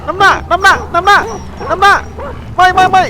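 very high, loud frantic shrieks; real panicked screaming. Real, not acted. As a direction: shriek in terror while running from wolves. Fleeing wolves in game. (กรีดร้องตื่นกลัวจริง เสียงสูงมาก ดังมาก)

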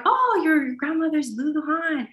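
playing the lady from the flight, pitched high; voicing the lady. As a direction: pitch way up to play the auntie who knows grandma. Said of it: "So they're like".

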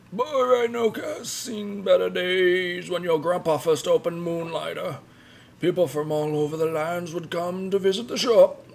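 put-on old man voice. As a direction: play the wise old shopkeeper. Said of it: "the old man voice".

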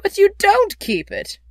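high, loud objection; earnest, high-pitched protest. A narrator's reading. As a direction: object with exasperated disbelief. Nephew protests to uncle.

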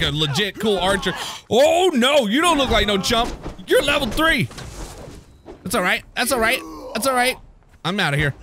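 ordinary talk

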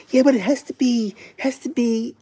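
high, whiny mock objection; mocking YouTube executives. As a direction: put on a high, pinched voice for nitpicking executives. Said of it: "and they're like".

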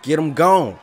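shouted, loud and high; hype shout. Real, not acted. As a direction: yell it out over the knockout.

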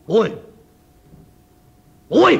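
高く大きな声で怒鳴りつける; 一声で女房を怒鳴りつける; 怒鳴り声. Acted. 亭主が怒鳴る. (高く大きな声で怒鳴る亭主)

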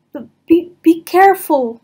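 high, loud, stammered warning; stammering fear. Acted. Piglet warns Christopher Robin, stammering in fear. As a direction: stutter the warning in fright. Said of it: "warned Piglet".

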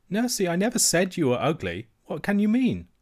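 put-on high, puzzled little girl; young princess bewildered; child princess. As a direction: ask in innocent dismay.

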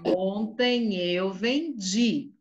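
very slow, each word drawn out; slow it right down so the child can follow; slow on request. Shown as a demonstration. Teacher repeats slowly after the child asks her to slow down. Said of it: "fala devagar".

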